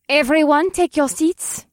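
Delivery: loud, high call across a classroom. Acted. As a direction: summon the whole room to order. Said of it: "Olivia's voice called out".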